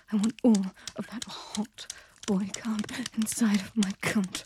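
ordinary talk

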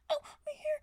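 sudden high yelp; high, loud startled cry; pained cry. Acted. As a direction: cry out as your hair is pulled.

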